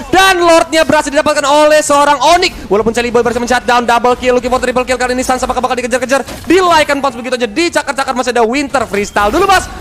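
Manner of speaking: high, loud hype play-by-play; esports caster. Acted. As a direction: call the teamfight at full excitement. Hyped play-by-play, triple kill.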